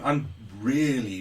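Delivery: usual speaking voice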